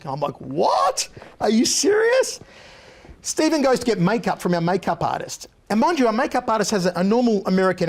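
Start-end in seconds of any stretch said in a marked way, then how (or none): 0.4-2.4 retold disbelief. Host reacting to handgun news.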